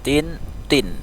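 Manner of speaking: "tin, tin": first t light, second heavy and rounded; soft then rounded t. Shown as a demonstration. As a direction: contrast a thin "tin" with a rounded "tin". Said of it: "agak dibulatkan".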